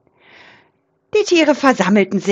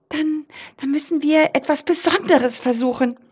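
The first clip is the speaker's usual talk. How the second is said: a small mouse with a big plan; determined squeak; 6 semitones higher than usual. Acted. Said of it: "piepste Rudi Maus entschlossen". (entschlossenes Piepsen)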